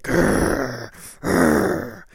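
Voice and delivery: loud rumbling growl; loud growling roar; loud tiger growls. Acted. Noise of fighting tigers.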